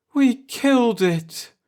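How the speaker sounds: high, slow moan of grief. A narrator's reading.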